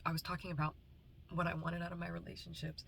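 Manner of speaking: ordinary delivery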